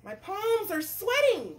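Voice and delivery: high, hammed-up fretting; nervous cook. Cook fears worms in his pants, acted nervousness. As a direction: squeal with storybook worry.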